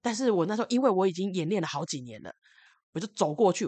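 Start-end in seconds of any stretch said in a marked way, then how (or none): none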